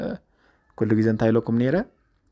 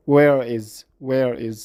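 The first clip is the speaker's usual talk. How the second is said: American full form; 4 dB louder, much slower than usual. Shown as a demonstration. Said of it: "ብኣሜሪካን ... ኣክሰንት".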